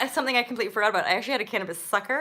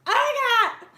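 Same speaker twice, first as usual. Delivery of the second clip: high, loud panicked oh God; much higher, frantic exclamation; shrill panic recall; 13 semitones higher, 7 dB louder, slower than usual. Real, not acted. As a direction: relive the fear out loud. Reliving terror.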